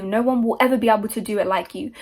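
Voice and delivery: ordinary delivery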